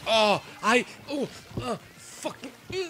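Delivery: high, stammering uhs that swing loud; flustered shock. Real, not acted. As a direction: sputter helplessly in surprise. Flustered shock, losing a fight.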